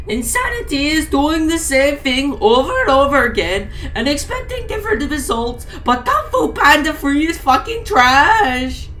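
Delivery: high, loud puppet voice; yelling goat puppet. Acted. Blue goat puppet yelling at the camera in a high voice. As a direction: cheer it like a manic puppet. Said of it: "cheered and he yelled".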